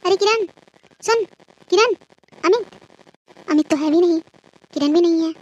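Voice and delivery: mother-in-law calling out for Kiran and Amit; calling for help. Acted. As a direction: shout for someone to come.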